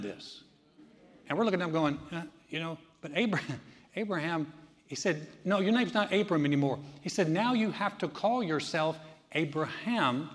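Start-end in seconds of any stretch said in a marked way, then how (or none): none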